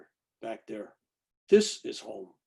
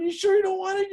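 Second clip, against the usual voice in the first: mock dentist voice; 7 dB louder than usual. Imitating the dentist mid-drilling.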